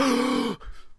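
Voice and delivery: high, hammed-up gasp; exaggerated gasp of dismay; mock shocked gasp. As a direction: suck in air in fake horror. Acted Hawkeye walking in on disaster.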